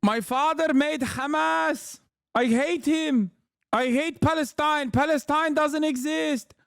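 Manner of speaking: high-pitched, hammed-up Arabic-accented impression; mock Arabic accent. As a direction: parody the guest in a thick Arabic accent. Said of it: "He just sounds like that".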